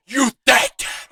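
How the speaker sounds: mock fury at a pushover; pissed disbelief. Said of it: "he was pissed".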